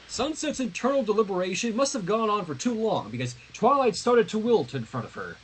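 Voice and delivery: plain reading voice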